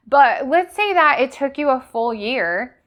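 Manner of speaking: normal talking voice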